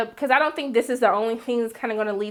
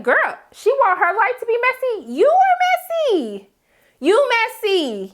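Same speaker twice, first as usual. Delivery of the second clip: much higher, slow, drawn-out incredulous call-out; indignant clapback; 10 semitones higher, 3 dB louder, slower than usual. Retold or imitated. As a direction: drag every word like you're calling her out. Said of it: "I was like".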